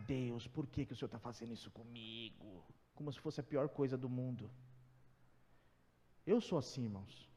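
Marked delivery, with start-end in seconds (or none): 0.1-2.6 much softer, lower self-pitying whine; whiny complaint to God. Pretend. Believer whining to God over a messy house, soft lower moan. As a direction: moan it under your breath like a sulking believer. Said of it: "Aí você vai pra Deus e fala".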